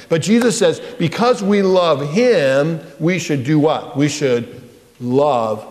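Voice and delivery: ordinary talk, nothing marked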